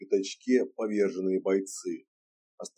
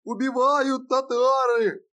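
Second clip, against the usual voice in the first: high, slow panicked shriek; 4 dB louder, slower than usual. A narrator's reading. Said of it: "заверещал пуще прежнего".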